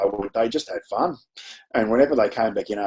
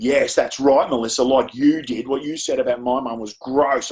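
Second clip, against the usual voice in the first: higher, heated mock outburst; exploding in anger; 5 semitones higher than usual. Girl across the classroom accuses Melissa, mock angry outburst. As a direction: imitate a schoolgirl blowing up in indignation. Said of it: "exploded with".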